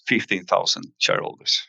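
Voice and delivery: ordinary talk, nothing marked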